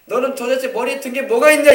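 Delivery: high, loud scolding; frustrated parent scolding a child. Retold or imitated. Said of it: "이 말이야".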